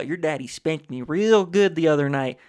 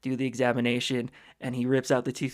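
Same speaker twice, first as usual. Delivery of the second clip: normal talking voice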